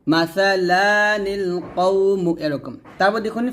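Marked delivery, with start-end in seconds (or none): 0.1-2.3 মাসালানিল কওমু drawn out, one alif length on লা; slow recitation. Shown as a demonstration. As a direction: recite মাসালানিল কওমু slowly with noon qutni. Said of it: "তাহলে এখানে পড়তে হবে". (ধীরে টেনে তিলাওয়াত)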